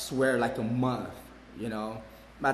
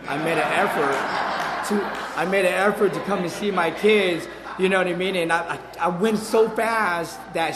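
laughing; 4 semitones higher, 6 dB louder than usual. Real, not acted. Laughing at his own logic.

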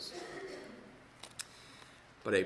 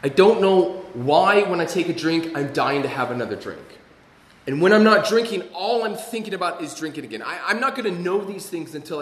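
higher, louder, pleading confession; impassioned; 7 semitones higher, 11 dB louder than usual. Real, not acted. Speaker confessing to the meeting, impassioned raised voice. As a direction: pour out the struggle.

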